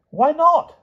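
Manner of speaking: very high, loud query; protesting king. A narrator's reading. King questions the knight's refusal, high and protesting. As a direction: challenge it in a high, indignant pipe.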